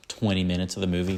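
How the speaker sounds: ordinary talk, nothing marked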